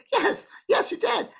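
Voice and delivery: very high imitated child; little girl. Little girl confirming it to the newly passed lady, high child imitation. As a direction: pipe it up as a small girl. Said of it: "a little girl about three or four".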